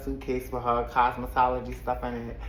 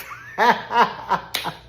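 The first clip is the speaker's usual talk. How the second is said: higher burst of laughter; laughing; 6 semitones higher, 3 dB louder than usual. Real, not acted. Host after voicing Kelsey's dare, amused laughter. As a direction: break up at your own impression.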